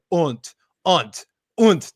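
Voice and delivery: unt said with a clipped Aussie vowel; Australian slang. Retold or imitated. Mocking how Australians swear, unt in an Aussie vowel. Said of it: "the liberal use of the C word".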